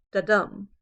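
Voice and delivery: "Da-dum" with a light "Da" and a strong "dum"; iambic stress. Shown as a demonstration. Teacher demonstrating an iamb, stress on the second syllable of "Da-dum". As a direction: punch "dum" after a weak "Da". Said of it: "one offbeat followed by a strong accent".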